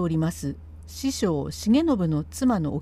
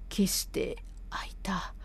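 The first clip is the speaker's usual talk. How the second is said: whispered wince of pain; hushed pained aside; 3 semitones lower, 10 dB quieter than usual. Acted. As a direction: gasp out the ouch through a cramp. Feigned cramp mid-sentence.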